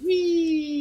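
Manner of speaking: very high, playful squeal; gleeful wee. Real, not acted. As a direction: squeal like on a ride. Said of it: "spinning around in my chair, just going".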